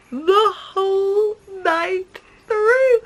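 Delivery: high, slow cooing repeat. Real, not acted. Repeat, cooed to baby.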